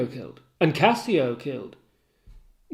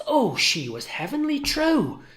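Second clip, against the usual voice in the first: ordinary talk, nothing marked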